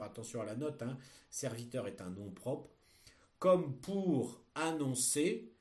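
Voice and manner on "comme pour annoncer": dictating. Shown as a demonstration. Teacher dictating a text.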